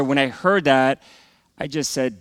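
ordinary talk

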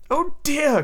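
high, delighted little gasp of words; excited cry. A narrator's reading. Wendy flattered by the boys' request, delighted cry. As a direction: exclaim like a flattered girl. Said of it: "cried Wendy".

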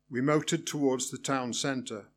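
usual speaking voice

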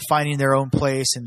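usual speaking voice

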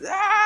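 high-pitched mock tantrum scream; imitated toddler scream. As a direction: imitate a screaming little boy without extra volume. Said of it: "I mean, he's screaming".

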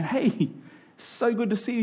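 ordinary talk

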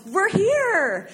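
high, bright teacher's shout of arrival; excited yell. Retold or imitated. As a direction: call it out like a thrilled teacher. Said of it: "Missus Flick jumps up and yells".

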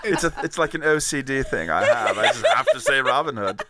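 plummy English gentleman Tony, hammed-up and pleased with himself; campy British toff accent, laughing through the lines; posh British accent. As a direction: play a pompous Englishman who cracks himself up. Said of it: "the accent is back".

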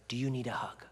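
soft, near-whispered question; gentle offer. Retold or imitated. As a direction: offer comfort very softly to an upset child. Parent gently offers hug.

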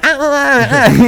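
high mock groans of a homeless man; imitated groaning. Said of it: "it sounded like that".

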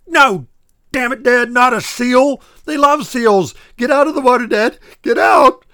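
screaming high and loud, desperate; frantic yell. Acted. Son screaming at his dad to leave the river, frantic. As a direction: yell him out of the shark's water.

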